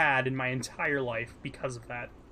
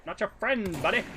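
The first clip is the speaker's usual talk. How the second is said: playful mock Canadian, pitched up; Canadian accent; 6 semitones higher than usual. Continuing the South Park loop, mock Canadian accent. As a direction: keep the South Park Canadian bit going. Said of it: "it's a Canadian voice".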